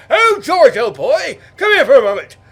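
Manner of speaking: hammed-up high British gent; loud, high mock aristocrat; posh mock toff. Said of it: "they said".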